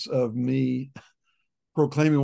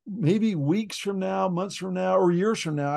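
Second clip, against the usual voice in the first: slow hesitant hedging; 6 semitones higher, slower than usual. Retold or imitated. Hedging to accountability partner.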